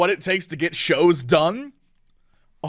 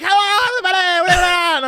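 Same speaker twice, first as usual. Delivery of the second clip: high, buzzing over-eager hello; a nervous host's hello, shaky and eager; excited shaky greeting; 12 semitones higher, 3 dB louder than usual. Retold or imitated. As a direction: blurt it out like you're vibrating with nerves. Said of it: "I get a little excited, and I just start shaking".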